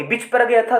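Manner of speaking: बीच said quickly as bich; wrong short vowel. Shown as a demonstration. Teacher warning students of the mistake, short i in बीच. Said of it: "ये हो गया short sound बीच".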